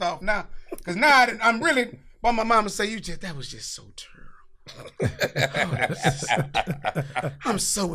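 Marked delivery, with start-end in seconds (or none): 2.9-5.0 mama's disapproval. Retold or imitated. Mother's verdict on the fight.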